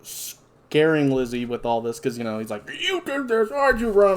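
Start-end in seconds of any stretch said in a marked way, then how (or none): 2.9-4.2 much higher hammed-up blaming; mock freak-out. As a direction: imitate a man losing it and accusing. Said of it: "freaks out and he's blaming".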